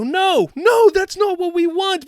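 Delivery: high, loud protesting fan voice. Retold or imitated. Fans outside Lucasfilm objecting, high loud mock protest. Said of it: "As fans outside Lucasfilm go".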